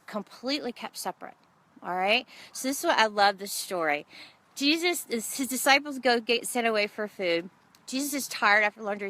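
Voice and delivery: ordinary delivery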